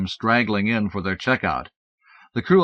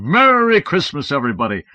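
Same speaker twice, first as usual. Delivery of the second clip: Santa's merry greeting; 5 semitones higher, 7 dB louder than usual. Acted. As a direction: boom it to the whole room. Helpers greeting the kids.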